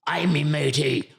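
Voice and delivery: pirate accent. Shown as a demonstration. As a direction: draw out the orders in a stagey pirate accent. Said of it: "That's a pirate accent".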